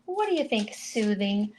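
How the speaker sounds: ordinary talk, nothing marked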